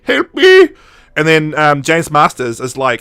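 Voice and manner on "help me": high, drawn-out cry for help; hammed-up victim begging loudly; mock plea for help.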